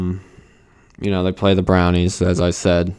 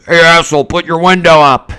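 mock angry yell; 8 semitones higher, 8 dB louder than usual. Same insult said once more.

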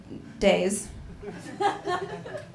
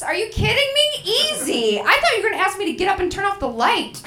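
excited; 8 semitones higher than usual. Retold or imitated. Eager reply to boyfriend.

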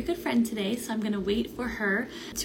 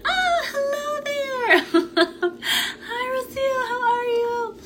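much higher, slow, delighted hello with a laugh; excited greeting; 3 dB louder, much slower than usual. Real, not acted. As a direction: greet a dear friend warmly and brightly, high and beaming. Greeting her guest coming on live.

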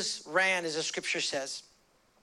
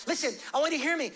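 ordinary talk, nothing marked

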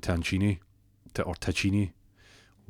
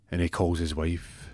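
ordinary talk

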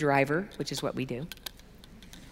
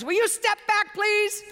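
high-pitched startled request, imitated; scared officer; 11 semitones higher than usual. Airport guard tells traveller to step back, imitated fright. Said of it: "Scared him to death".

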